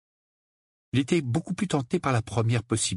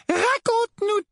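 very high, drawn-out motherly coaxing; murmuring doting mother; 4 dB louder, slower than usual. A narrator's reading. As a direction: coax your darling child slowly. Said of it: "murmura la tante Pétunia". (mère qui cajole)

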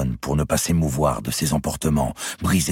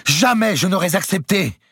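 high, loud outcry; exclaimed; 5 dB louder than usual. A narrator's reading. Clémence cries out against Louis, exclaiming. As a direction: cry out in protest. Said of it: "s'écria Clémence".

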